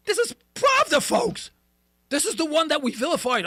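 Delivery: high, loud outraged rant. Real, not acted. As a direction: hammer it home, almost shouting. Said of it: "host ranting about Pravda".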